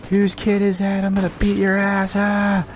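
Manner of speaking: high-pitched mock parent threats; angry parents. Hockey parents threatening a kid from the stands, high mock anger. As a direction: play a furious hockey parent.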